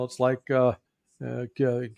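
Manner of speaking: ordinary talk, nothing marked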